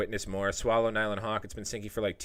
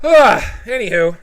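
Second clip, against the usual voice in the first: long sighing anywho; 10 semitones higher, 14 dB louder, much slower than usual. Real, not acted. Sigh before sign-off.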